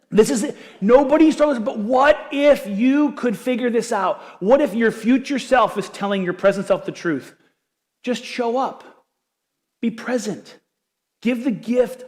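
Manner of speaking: high, loud, rising preacher's appeal; pleading with the room to wake up; impassioned urging. Real, not acted. Pastor urging his church to show up, impassioned.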